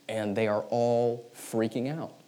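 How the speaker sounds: usual speaking voice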